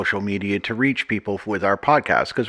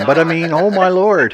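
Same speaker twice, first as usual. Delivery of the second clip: loud, high mock exasperation; sarcastic; 8 semitones higher, 5 dB louder than usual. Real, not acted. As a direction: roll your eyes at it. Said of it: "Pardon the sarcasm".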